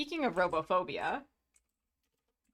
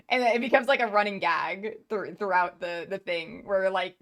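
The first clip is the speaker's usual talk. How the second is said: ordinary talk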